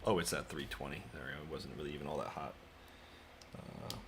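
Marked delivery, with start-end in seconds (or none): none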